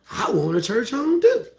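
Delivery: high, loud mock wail; hammed-up tearful longing; whiny crying plea. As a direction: wail it like a jealous churchgoer. Mocked longing for church home.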